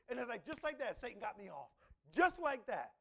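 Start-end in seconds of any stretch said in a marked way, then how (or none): none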